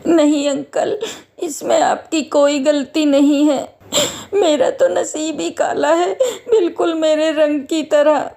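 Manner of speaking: tearful. Acted. Orphaned girl tells the guard it is her fate, tearful. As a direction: speak through tears, resigned and small.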